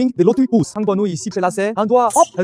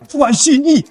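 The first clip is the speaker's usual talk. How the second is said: higher, emphatic burst on "génie"; exultant exclamation; 5 semitones higher, 4 dB louder than usual. Acted. Realising he is a genius, exultant exclamation. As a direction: proclaim it as a triumphant discovery. Conclut qu'il est un génie.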